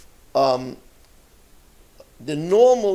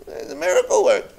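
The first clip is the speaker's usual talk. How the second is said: much higher, fast excited cry of awe; excited praise; 12 semitones higher, faster than usual. Retold or imitated. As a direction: gush it out in amazement. Crowd praising the Rebbe.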